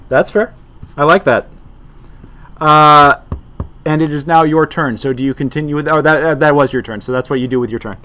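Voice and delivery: ordinary talk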